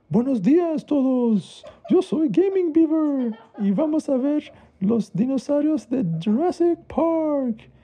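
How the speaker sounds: high, loud, slow showman greeting; Gaming Beaver host voice. Acted. Child imitating his favorite YouTuber, hammed-up high host voice. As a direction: ham it up like a kid's YouTube host.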